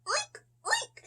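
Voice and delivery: very high squeaky oink; child oinking for the pigs. Acted. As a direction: squeal it like a piglet. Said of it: "says the pigs".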